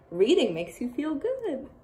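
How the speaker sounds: ordinary delivery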